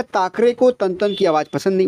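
ठाकरे said as ताकरे with a plain dental t, in a British accent; British लहजे में ठ को त बोलना; British accent on Hindi. Shown as a demonstration. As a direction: imitate a British speaker who can't say ठ, ठन becoming तन. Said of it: "वो कैसे कहेगा? वो कहेगा".

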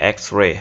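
"এক্স রে" as eks-rey, rising; correct pronunciation. Shown as a demonstration. Teacher confirming the word for students, correct pronunciation. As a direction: say "এক্স রে" with a clear ks. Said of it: "এটা সঠিক".

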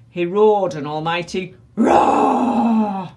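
loud, slow roared line; roaring. A narrator's reading. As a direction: roar the words out. Narrating a roar.

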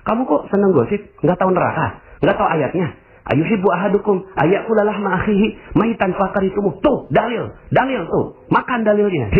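high-pitched preachy telling-off; mock scolding. Self-righteous believer scolds a gossiper with scripture, hectoring.

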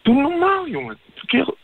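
high, sharp yell; telling his bragging friend to stop, high and angry. Real, not acted. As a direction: cut him off angrily. Furious at friend over sister.

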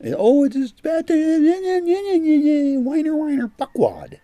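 high, loud drawn-out whine, dropping at the end; hammed-up squealing whiner; mock whining. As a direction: mimic a screaming crybaby in a mocking high pitch. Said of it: "you're gonna be whining and screaming".

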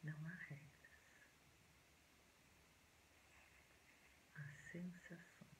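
soft, hushed meditation voice with long pauses; quiet, unhurried relaxation guidance; guided meditation. Acted.

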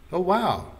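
high, loud exclamation of wonder; delighted surprise. A narrator's reading. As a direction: react amazed at the coincidence. Girl surprised wife is from Edmonton.

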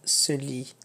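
ordinary talk

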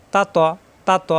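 light open 'তা', then heavy rounded 'ত'; thin then thick. Shown as a demonstration. Teacher drilling the difference for learners, thin 'তা', thick 'ত'. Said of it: "ত মোটা, তা পাতলা".

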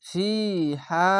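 very slow intoned ফিহা, each vowel held; sung Arabic recitation; Quran recitation. Shown as a demonstration. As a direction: stretch ফিহা one alif per vowel. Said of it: "ইয়া সাকিন এক আলিফ টেনে পড়বেন".

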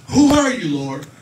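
loud, high, panicked shout; terrified shout at the light; terrified cry. Retold or imitated. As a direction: cry out in terror at the blinding voice. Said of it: "it says he trembling and astonished".

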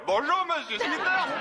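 a big high yell, hammed up for the story. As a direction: bellow it so the next county hears. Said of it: "d'une voix qu'on entendrait dans le comté voisin, moi, je lui hurle".

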